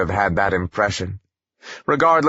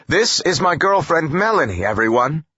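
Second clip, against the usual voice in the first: higher, projected introduction to all; louder announcement; 7 semitones higher than usual. A narrator's reading. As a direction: announce it for the whole room. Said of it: "repeated louder for all to hear".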